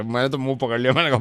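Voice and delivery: usual speaking voice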